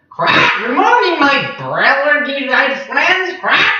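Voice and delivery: high, squawking cheerful parrot. Acted. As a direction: greet them like a jolly parrot. Papagayo greets the night creatures.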